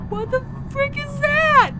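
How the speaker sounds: very high, loud freaked-out shriek; startled yell. Acted. Student is frightened by something in the dark, startled yell. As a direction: climb to a piercing yell on the last word.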